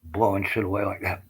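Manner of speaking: normal talking voice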